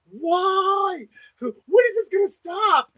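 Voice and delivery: high, loud anguished cry; screaming at God. Retold or imitated. To God in private, a high loud desperate scream. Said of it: "there's times I scream at God".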